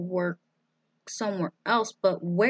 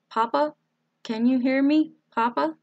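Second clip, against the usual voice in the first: higher, louder questioning call; 6 semitones higher, 5 dB louder than usual. A narrator's reading. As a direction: call to Papa through a closed door. Sarah calls through the door.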